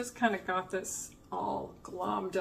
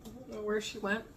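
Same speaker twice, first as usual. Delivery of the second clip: ordinary talk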